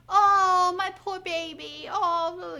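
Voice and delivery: high, loud mock-doting "oh, my poor baby"; hammed-up fussing mother, dripping pity; mocking coo. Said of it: "going on and on and on about it".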